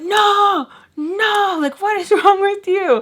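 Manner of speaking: loud, high-pitched mock yell; imitated angry outburst. Man blaming her in a quarrel, loud high outburst. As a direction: cry out in exasperated disbelief, imitating him.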